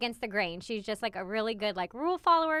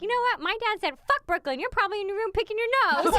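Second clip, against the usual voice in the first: high, louder imitation of a child talking back; defiant little girl; 10 semitones higher, 5 dB louder than usual. Mother quoting daughter confronting her bully, high and defiant. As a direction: imitate a kid standing up for herself, pitched up. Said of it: "and she's like".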